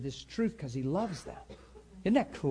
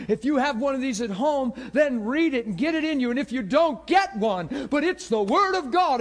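high, loud pulpit exhortation, building; imitating a preacher on fire; fiery preaching; 7 semitones higher, 6 dB louder than usual. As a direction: preach it with fire, driving louder and higher. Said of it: "preaches like a preacher with fire".

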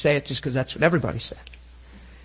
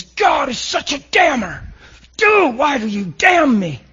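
high, slow exasperated shout; hammed-up outrage, pitch way up; acted angry accusation; 11 semitones higher, 4 dB louder than usual. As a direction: yell it as an aggrieved complaint. Acting out the curse's meaning.

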